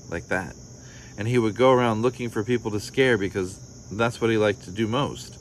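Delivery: ordinary delivery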